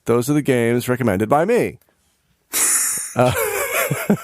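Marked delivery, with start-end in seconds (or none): 3.4-4.1 high giggle. Real, not acted. Laughing at the joke.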